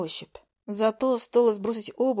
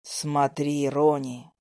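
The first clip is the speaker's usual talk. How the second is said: low, slow booming dragon command; a lower voice of a domineering dragon; 7 semitones lower, much slower than usual. A narrator's reading. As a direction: order her like a giant ancient beast. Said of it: "властно приказал мне голос".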